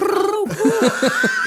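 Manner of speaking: loud, high, hammed-up French accent; exaggerated French. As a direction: overdo the French sound for laughs. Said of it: "that's a different level of French".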